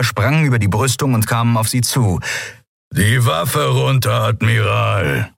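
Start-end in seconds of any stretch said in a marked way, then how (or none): 2.9-5.3 slow, drawn-out growled order; growling giant. A narrator's reading. Giant alien disarming the admiral, slow growled order. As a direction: growl it slowly, stretching each word. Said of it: "brummte der Skria".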